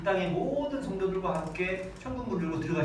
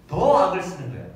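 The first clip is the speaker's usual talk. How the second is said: loud emphasis; 7 dB louder than usual. Real, not acted. Church screaming defiantly.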